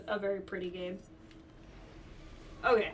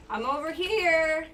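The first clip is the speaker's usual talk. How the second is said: calling out; 6 semitones higher, 6 dB louder, slower than usual. Real, not acted. So I gotta trick it.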